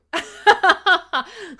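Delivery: loud real laughter at the chat. Real, not acted. Host laughs at the chat, loud laugh.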